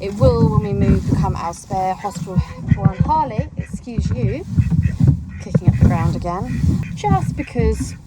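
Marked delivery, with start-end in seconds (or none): 3.0-7.9 high, loud mock telling-off of the dog; a playful rebuke aimed at Harley; mock scolding. Real, not acted. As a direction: call the dog out with a teasing scold. Scolding the dog mid-task.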